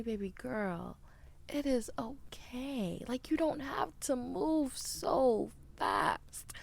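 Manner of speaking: slow, whispery, tender mentor's comfort; gentle soothing advice. Retold or imitated. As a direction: soothe her like a mother figure, slowly.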